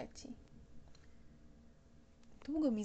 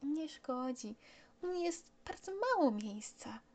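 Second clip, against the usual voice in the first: put-on higher boyish voice, gently serious; grave child voice; 5 semitones higher than usual. Little prince tells pilot seriously, a hint of grave child voice. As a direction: state it earnestly like a small boy. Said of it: "stwierdził z powagą".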